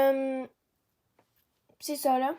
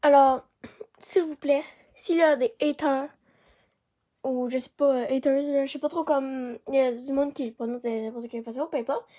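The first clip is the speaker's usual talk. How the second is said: ordinary delivery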